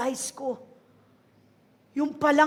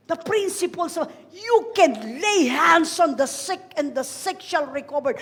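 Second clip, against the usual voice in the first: usual speaking voice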